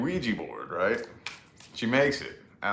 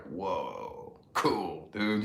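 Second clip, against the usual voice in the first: put-on low, quiet, drunk and dazed; 3 semitones lower, 8 dB quieter, much slower than usual. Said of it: "I was a blithering fucking alcoholic".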